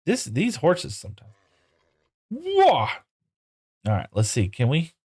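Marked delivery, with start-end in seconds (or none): 2.3-2.9 very high sudden whoa; real surprise; startled whoa. Real, not acted. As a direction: caught off guard by the horse. Horse startles him.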